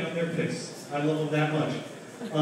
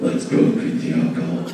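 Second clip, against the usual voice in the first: low raspy laughing Batman whisper; 8 dB louder than usual. Retold or imitated. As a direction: growl it like Batman. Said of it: "you turn into Batman".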